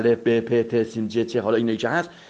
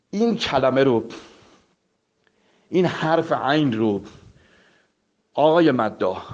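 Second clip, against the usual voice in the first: ordinary delivery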